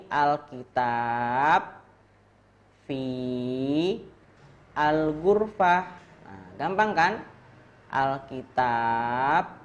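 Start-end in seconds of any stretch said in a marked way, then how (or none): 0.1-5.8 slow model reading. Shown as a demonstration. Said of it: "ini cara bacanya gimana tadi". 7.9-9.6 slow model reading. Shown as a demonstration. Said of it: "ini cara bacanya gimana tadi".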